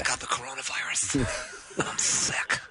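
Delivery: slow, soft fake-patient voice; feebly milk a pretend illness; feigned sickness. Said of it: "Oh, is it sick?".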